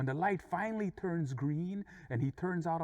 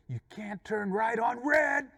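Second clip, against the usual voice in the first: high, loud, drawn-out yell; shouted rebuke; 7 semitones higher, 6 dB louder, slower than usual. Retold or imitated. Other driver shouts the road rule back, shouted rebuke. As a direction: yell it slowly, every word held up high. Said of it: "he said".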